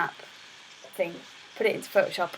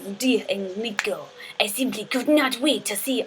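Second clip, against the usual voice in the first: hushed letter in mock French accent; accidental French accent. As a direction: read it out with a badly faked French accent. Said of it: "why am I French now".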